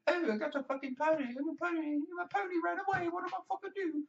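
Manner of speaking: very high-pitched put-on English voice; mock English accent. Southerner pretends he was born in England, mock English accent. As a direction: squeal it out as a cartoonish English caricature. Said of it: "if I was in England".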